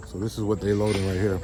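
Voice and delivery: talking while chewing seeds; words around a mouthful of seeds; cracking sunflower seeds. Real, not acted. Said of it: "I'm eating sunflower seeds".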